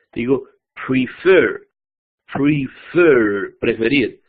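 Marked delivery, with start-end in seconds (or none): 0.9-1.7 "prefer" con la segunda sílaba, "fer", acentuada; acento final. Shown as a demonstration. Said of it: "la segunda sílaba tiene el acento". 2.3-3.6 "prefer" lento, con "fer" fuerte y alargado; acento final. Shown as a demonstration. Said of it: "la segunda sílaba tiene el acento".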